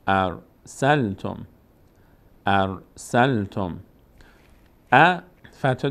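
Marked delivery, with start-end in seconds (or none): 0.1-3.8 slow syllable reading. Shown as a demonstration. Teacher slowly sounds out syllables for pupils. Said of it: "سین میچسبه به لام".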